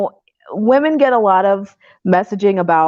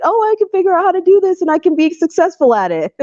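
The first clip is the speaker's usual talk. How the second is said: mocking eager voice; 10 semitones higher, faster than usual. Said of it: "the other part of me is like".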